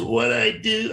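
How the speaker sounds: imitating a thick Brazilian accent. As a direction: play a Brazilian fighter, a bit hammed-up. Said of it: "really thick Brazilian accent".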